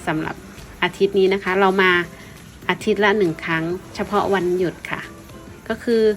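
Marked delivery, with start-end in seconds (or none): none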